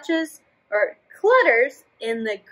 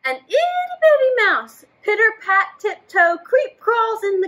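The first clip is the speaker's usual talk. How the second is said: tiny high voice; 8 semitones higher than usual. Acted. Tiny mouse described.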